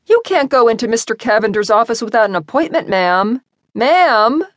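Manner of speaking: flustered receptionist chasing someone down the hall; calling after her. A narrator's reading.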